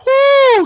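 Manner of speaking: high, loud "woo"; celebratory whoop. Real, not acted. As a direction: whoop it out in triumph. Cheering the touchdown.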